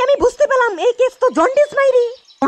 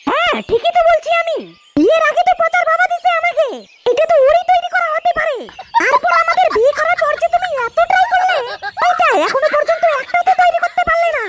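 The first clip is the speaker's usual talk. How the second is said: taunting wife voice; 5 semitones higher than usual. Acted.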